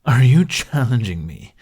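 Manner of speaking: loud, scornful challenge; scornful bark. A narrator's reading. Toothless mocking the dragoness's threat, loud scornful bark. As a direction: laugh off her threat loudly. Barked back in a short laugh.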